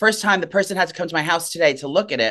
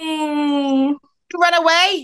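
much higher, very slow mock scolding; 11 semitones higher, 3 dB louder, much slower than usual. Real, not acted. Scolding the dog in baby talk.

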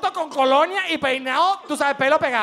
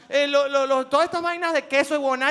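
usual speaking voice

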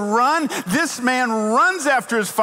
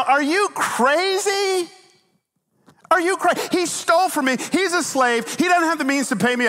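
indignant refusal; 9 semitones higher than usual. Acted. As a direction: play the wronged master digging in. Imagines Philemon refusing Paul's request.

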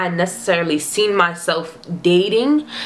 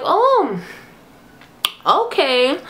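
surprised oh okay; 7 semitones higher, much slower than usual. Retold or imitated. Reacting in surprise to text.

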